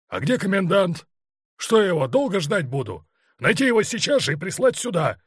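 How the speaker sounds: high, loud impatient orders; commanding shout. A narrator's reading. Colonel demanding the missing commandant at once, shouted command. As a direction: order them about angrily. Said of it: "командовал Черняк".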